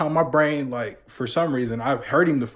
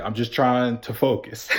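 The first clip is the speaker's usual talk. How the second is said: words through laughter; can't hold the laugh in; 3 semitones lower than usual. Retold or imitated. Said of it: "And I was like".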